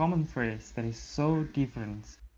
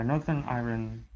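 normal talking voice

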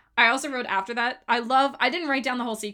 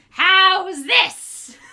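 much higher, louder call; calling from afar; 8 semitones higher, 11 dB louder than usual. Retold or imitated. Fan quoting Yzma calling from a distance, loud. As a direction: call it from far away. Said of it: "she goes".

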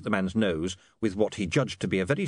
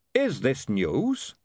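slow doubtful question; 4 semitones higher, much slower than usual. A narrator's reading.

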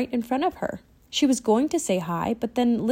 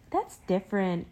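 ordinary delivery, nothing marked

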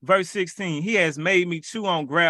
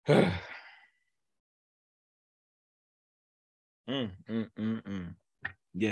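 choked up; 7 semitones lower, 7 dB quieter than usual. Real, not acted. Overcome remembering being broke.

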